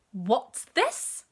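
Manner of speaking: loud, startled exclamation; a mother spotting the cat and exclaiming. A narrator's reading. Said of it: "exclaimed the mother".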